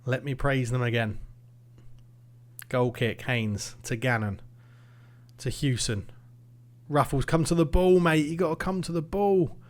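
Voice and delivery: normal talking voice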